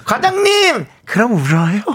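high-pitched cutesy wail to the boss; hammed-up cute pleading; cute back talk. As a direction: whine it adorably, way up high. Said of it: "한번 귀엽게".

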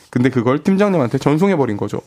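normal talking voice

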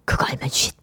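soft, voiceless shushing reply; hushing whisper. Acted. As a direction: whisper it like a playful hush. 문자 속 아내의 대답을 속삭임. (쉿 하듯 속삭이는 말투)

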